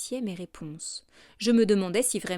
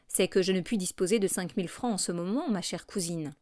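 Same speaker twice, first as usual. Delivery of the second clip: ordinary delivery, nothing marked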